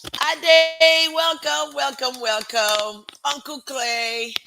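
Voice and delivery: loud, high drawn-out greeting; excited welcome. Real, not acted. Host hails arriving viewers by name, excited welcome. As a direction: call out a delighted hello, stretching the names.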